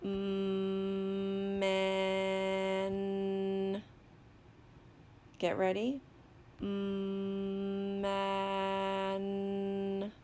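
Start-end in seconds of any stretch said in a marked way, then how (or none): 0.0-3.8 "Mm, man" with each sound held long on one pitch; slow sounding-out. Shown as a demonstration. Teacher sounds out another word, slowly. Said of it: "going to sound out another word". 6.6-10.1 "Mm, man" with each sound held long on one pitch; slow sounding-out. Shown as a demonstration. Teacher sounds out another word, slowly. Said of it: "going to sound out another word".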